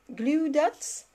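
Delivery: self-mocking attempt at an English accent; put-on English accent. Shown as a demonstration. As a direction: show off an English accent, rising at the end. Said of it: "mon magnifique accent anglais".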